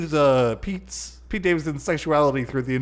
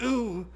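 frightened ooh; 12 semitones higher than usual. Retold or imitated. Pete grabbing his butt, scared.

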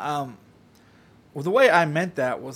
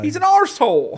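high, loud cartoon Canadian voice; a hammed-up Canadian from up north; mock Canadian accent; 10 semitones higher, 5 dB louder than usual. Telling Justin where he lives, in a mock Canadian accent.

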